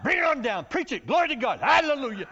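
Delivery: high, loud call-and-response shouts; hammed-up congregation hollering; church shouts. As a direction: shout it back at the preacher like a congregation. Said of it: "they're yelling at you".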